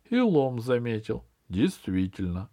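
ordinary delivery

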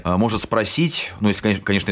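usual speaking voice

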